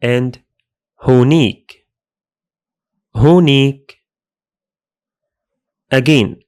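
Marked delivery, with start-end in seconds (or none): 1.0-1.9 هونيك said as "ho-nik", falling at the end; teacher model of هونيك as "ho-nik"; Levantine demo word. Shown as a demonstration. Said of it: "speakers usually say". 3.2-3.9 هونيك repeated as "ho-nik", a little louder; Levantine demo word. Shown as a demonstration. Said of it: "speakers usually say".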